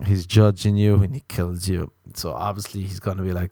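normal talking voice

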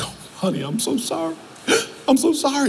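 high, soft tearful whimper; breathy sobbed apology, imitating a crying husband; mock crying. Husband confessing to his wife in a TV show, mock crying. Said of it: "he's crying".

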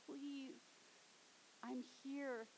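Jesus to the widow, soft and compassionate; compassionate plea. Acted. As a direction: reach out tenderly. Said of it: "this compassionate heart saying".

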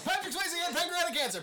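race caller. Pretend. Mock race call.

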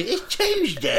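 hammed-up, high British voice, laughing; British accent. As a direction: put on a silly slow British accent. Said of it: "have a British accent".

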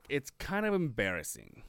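ordinary talk

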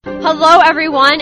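ordinary talk, nothing marked

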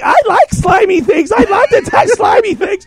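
put-on high, loud childlike delight with a laugh; giddy little-girl voice. Bunny in little mode gushes over slime, giddy and high. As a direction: gush like a kid with slime.

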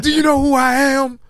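high, slow, indignant demand; arrogant outburst. Retold or imitated. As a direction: puff up like a celebrity insulted at not being recognized. Retold arrogant customer's outburst.

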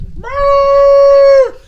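piercing high screeching trill; loud screech. Retold or imitated. Millie shattering the city glass with a loud high screech. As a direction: screech as loud as you can. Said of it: "The fucking screech is so loud".

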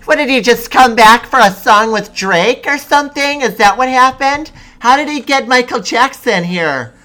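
loud, high, whiny mom impression; mock mom's confused squeal. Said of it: "I was making fun of her".

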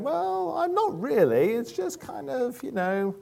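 high, hesitant imitation of a woman; flustered lady. Lady answering a child's awkward question, high hesitant mimicry. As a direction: stall in a high-pitched put-on voice, drawing words out. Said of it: "the lady went".

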